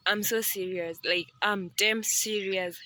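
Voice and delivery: ordinary talk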